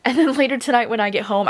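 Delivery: usual speaking voice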